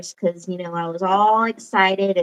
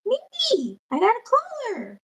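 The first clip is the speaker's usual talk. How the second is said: excited retold; 13 semitones higher than usual. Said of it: "And I said".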